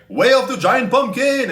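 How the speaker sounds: shouted like an anime special attack; overacted anime dub. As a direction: yell it out like a hero's power move. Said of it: "sound like anime character special attacks".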